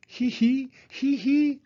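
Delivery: high little piggy giggle; giggling. A narrator's reading. Narrator giggles for Peppa and Susie, high and playful. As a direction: giggle like two small girls. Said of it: "Peppa and Susie giggled".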